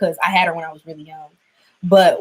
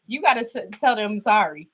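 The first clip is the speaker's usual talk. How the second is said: normal talking voice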